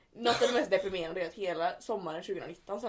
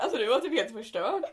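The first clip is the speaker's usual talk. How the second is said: normal talking voice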